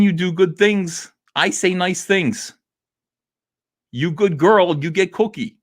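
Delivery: sing-song pet praise. Acted. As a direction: talk down like praising a dog. Talking down to YouTube like a pet.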